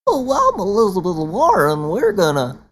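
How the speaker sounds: mock her as a goofy, chirpy woman; Elizabeth Warren impression. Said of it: "Because that's how she sounds".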